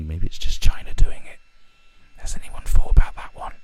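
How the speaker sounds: loud stage whisper, close up; conspiratorial whisper. Pretend. Ranter confiding to listeners that China is behind it, mock conspiratorial whisper. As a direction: whisper it like a secret theory.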